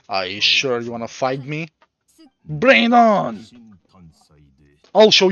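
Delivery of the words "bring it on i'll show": loud taunt. Acted. Taunting an opponent in game.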